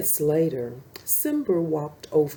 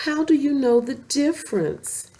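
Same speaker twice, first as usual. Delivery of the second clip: higher, wondering cub voice; curious cub; 8 semitones higher than usual. Acted. Simba asking his mother, a little higher and curious. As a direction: ask it like a small curious cub.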